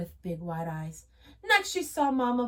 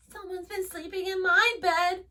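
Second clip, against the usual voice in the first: high squeaky little bear; 10 semitones higher, 4 dB louder than usual. A narrator's reading. Baby Bear finds his bed taken, high and squeaky. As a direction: squeak it like a tiny cub. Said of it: "squeaked Baby Bear".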